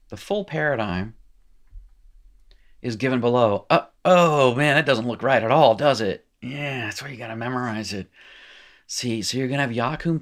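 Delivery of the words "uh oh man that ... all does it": louder, higher mock alarm; big uh-oh reaction, hammed up; mock dismay. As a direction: react with playful, exaggerated dismay.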